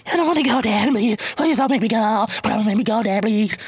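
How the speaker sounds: put-on high, whiny begging of a frightened little boy; scared pleading kid. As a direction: beg like a kid too scared to go to the door.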